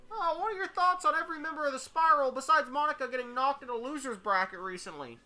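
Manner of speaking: high-pitched audience questioner; guy in the crowd. Acted. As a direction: put on a high voice to pose a question. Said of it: "Some guy in the crowd asks".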